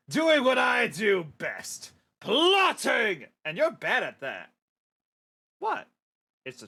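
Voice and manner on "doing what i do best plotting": slow, loud villain boast; gloating villain. Acted. King Zornag bragging to his minions, loud gloat. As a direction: proclaim it like a proud evil king.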